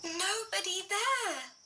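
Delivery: ordinary delivery, nothing marked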